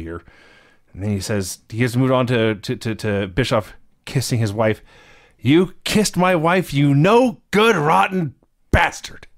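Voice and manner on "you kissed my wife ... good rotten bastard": furious retold promo. Retelling Flair's promo to his co-host, slow mock fury. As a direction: rage at the man who kissed his wife. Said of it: "He's delivered it with such fury".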